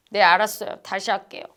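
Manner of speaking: grudging, pouty compliance; sulky pout. Acted. Subordinate grudgingly answering the boss, sulky pout. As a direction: answer through pushed-out lips, reluctantly. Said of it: "입을 이렇게 내밀어".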